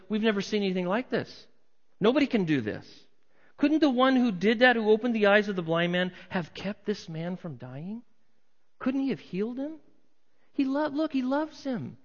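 high, urgent crowd protest; incredulous onlookers. Acted. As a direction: plead the case as a baffled bystander. Acts out crowd questioning Jesus' absence.

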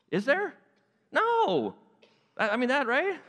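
high-pitched, baffled arguing with God; incredulous protest. Acted. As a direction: question the order in comic disbelief.

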